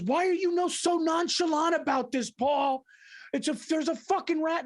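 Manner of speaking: high-pitched mock outrage; imitating upset wife. Retold upset wife confronting husband.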